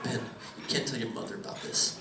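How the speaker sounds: hushed nervous plea, mildly voiced; nervous dad. Comic quoting dad begging secrecy in the driveway. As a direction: beg quietly, lightly played. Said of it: "he goes".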